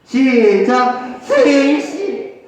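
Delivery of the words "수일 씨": theatrical, slightly raised pleading call; plaintive plea. Shown as a demonstration. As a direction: implore like a melodrama heroine. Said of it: "애절하게 해야지". (신파극처럼 애절하게)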